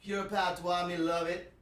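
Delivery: light Jamaican accent impression; Jamaican patois accent. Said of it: "into the Jamaican accent, the patois".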